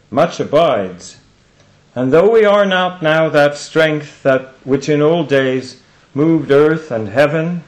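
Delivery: slow, loud, measured verse with long pauses; poem recitation. Acted. Speaker reciting a poem to the audience, slow declamation. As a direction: declaim the verse in weighty, spaced phrases.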